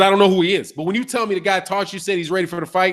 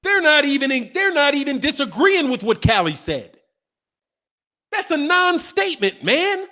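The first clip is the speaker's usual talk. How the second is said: incredulous; 7 semitones higher than usual. Real, not acted. Host challenges the other side's claim.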